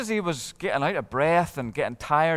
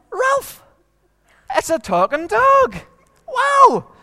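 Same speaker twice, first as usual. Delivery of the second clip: much higher and louder, wide-eyed wonder; amazed; 8 dB louder, much slower than usual. Acted. Eric amazed he's found a talking dog, high and loud. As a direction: gasp it out, can't believe it.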